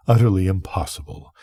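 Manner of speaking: ordinary delivery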